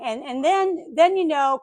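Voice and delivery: usual speaking voice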